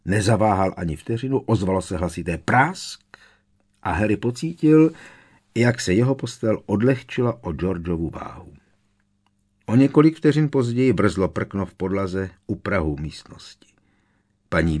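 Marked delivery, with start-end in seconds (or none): none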